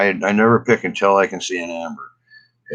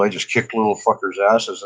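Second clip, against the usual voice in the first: ordinary talk, nothing marked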